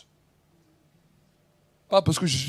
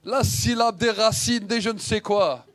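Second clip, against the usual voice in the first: ordinary talk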